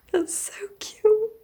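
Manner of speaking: high-pitched gushing whisper; gushing squeal. Real, not acted. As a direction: squeal it like you're melting. Gushing over the book.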